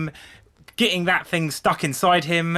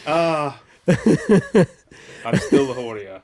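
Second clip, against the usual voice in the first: laughing; 4 dB louder, much slower than usual. Real, not acted. Laughing at the article's summary.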